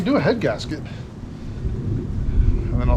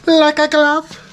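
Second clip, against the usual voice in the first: much higher and louder, a put-on voice; hammed catchphrase; 12 semitones higher, 6 dB louder, much slower than usual. Mechanic delighted the part fits, hammed loud catchphrase. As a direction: belt it out like a movie catchphrase.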